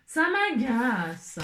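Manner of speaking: "Ça m'agace" stretched very slow, each syllable dragged; drawn-out exasperation. Shown as a demonstration. Crafter to viewers, dragging "Ça m'agace" out very slowly. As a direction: drag out "Ça m'agace" as if worn out.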